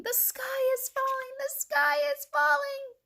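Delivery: very high squawked alarm; squawking. A narrator's reading. Chick warning the hen house, squawking.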